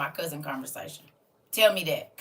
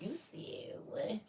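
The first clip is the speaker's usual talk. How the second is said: surprised whisper; 13 dB quieter, much slower than usual. Real, not acted.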